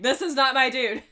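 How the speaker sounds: mock Animal. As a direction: refuse to be fooled.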